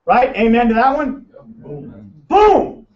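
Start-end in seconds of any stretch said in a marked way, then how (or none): 1.6-2.1 a breathy, near-silent boom; soft boom. Pretend. Hushed boom to the room before the big one. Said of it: "[name] loves that boom". 2.3-2.9 a big, high, punched "Boom."; loud boom. Pretend. Speaker booms it to the crowd, loud and high. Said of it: "[name] loves that boom".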